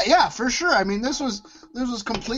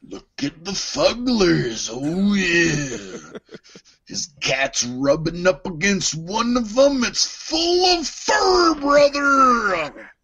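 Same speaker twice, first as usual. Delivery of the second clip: Macho Man Randy Savage; slower than usual. Acted. Host riffs on fuzzy slippers, as Macho Man. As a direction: stretch it out like a hyped-up wrestling promo. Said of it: "do one episode fully in character".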